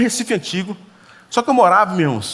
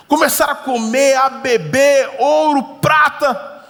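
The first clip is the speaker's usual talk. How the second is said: excited; 7 semitones higher, 3 dB louder, slower than usual. Acted. Describing the banquet with wonder.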